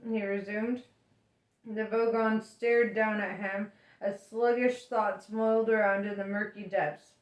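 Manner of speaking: ordinary delivery, nothing marked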